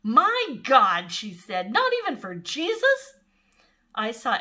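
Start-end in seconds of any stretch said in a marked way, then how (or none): 0.0-1.1 loud startled gasp of words; a kid swearing in church; shocked exclamation. A narrator's reading. 1.7-3.1 much higher, indignant question; outraged disbelief. A narrator's reading. Imogene outraged at no room.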